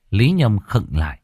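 usual speaking voice

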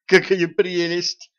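high, slow delighted squeal. Acted. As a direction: squeal Какая прелесть with delight. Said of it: "взвизгнула девчонка".

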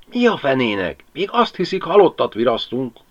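a hint of a grumbling peasant; Pali voiced. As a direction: Pali brushing off the lamp, lightly voiced. Pali answering, voiced figure.

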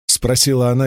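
plain reading voice

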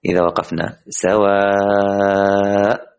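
very slow, سواء stretched out as a recited stop; teacher sounding out the stop on سواء; demo stop on sawa. Shown as a demonstration. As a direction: recite slowly, drawing out سواء at the pause. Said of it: "هكذا".

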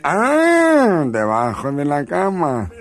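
high slow gloating discovery; gleeful realization. Acted. Husband sure he found the lover's hiding place. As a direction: savor the bright idea.

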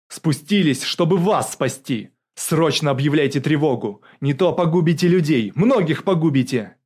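urgent warning. A narrator's reading. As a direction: press the general to act at once. Bogdanov urgently warns the general. (настойчиво, на повышенных тонах)

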